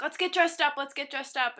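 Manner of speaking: put-on bouncy excitement; mock giddy. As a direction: imitate a giddy boyfriend nagging. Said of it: "all giddy for some reason".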